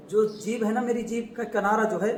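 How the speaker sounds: usual speaking voice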